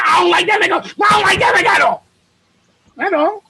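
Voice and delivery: high, hammed-up shouted protest; mock indignant outburst. Acts out angry dislike of someone.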